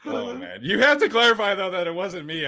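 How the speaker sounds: high, loud laughing protest; insist, flustered and loud. Real, not acted. Protesting the teasing.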